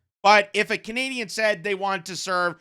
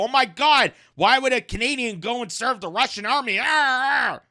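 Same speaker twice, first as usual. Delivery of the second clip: mock freakout; 6 semitones higher than usual. Imagining critics' reaction to a Russian case, mock outrage. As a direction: ham up a scandalized critic. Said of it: "you'd probably freak out and go".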